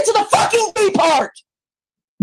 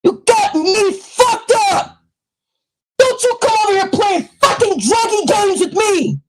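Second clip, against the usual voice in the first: furious shouting; 4 semitones higher than usual. Real, not acted. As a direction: tear into her, yelling at full force. Furious rant at a commenter.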